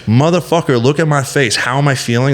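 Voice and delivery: fed up with people asking how he feels; exasperated retort. Retold or imitated. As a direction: snap back at the nosy question. Venting at people asking him.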